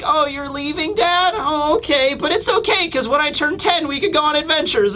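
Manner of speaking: put-on high-pitched little child voice; little Bonnie. Daughter answering her departing dad, in a high child voice. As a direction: play a small kid putting on a brave front. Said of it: "And Bonnie is just like".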